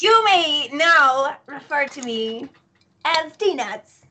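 slow, stately announcement of a new title; grand proclamation. Acted. Player's character announcing a new name to the party, grand proclamation. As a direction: draw it out like a self-crowned royal.

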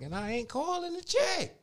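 sarcastic not-my-problem; mock indifference. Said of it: "I'm like".

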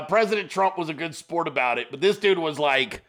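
ordinary talk

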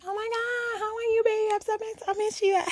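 high-pitched, loud fake gushing; mock gushing texter. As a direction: squeal it like a phony friend who misses you. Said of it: "come on my text message and text me".

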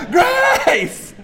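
put-on very high, loud child's scream. Son running through the hallways, screaming in play-acted alarm. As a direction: yell it out, pitch sky-high. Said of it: "running through the house screaming".

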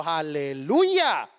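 slow, low, stretched Aleluya; drawn-out relieved hallelujah. Retold or imitated. She ends up serving beside him.

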